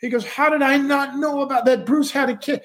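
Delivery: high, stunned disbelief; flipped out. Retold or imitated. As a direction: react in total shock. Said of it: "this guy really flipped out".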